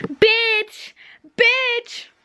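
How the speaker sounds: deux bitch criés très haut; crie-le encore, pour le défi; cri aigu répété. Real, not acted. Cap ou pas cap de crier bitch. (cris aigus répétés)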